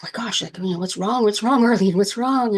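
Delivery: an anxious cousin pressing what's wrong; worried cousin. Retold or imitated. Cousin chasing her, asking what's wrong, rising alarm. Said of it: "my cousin follows me and she's like".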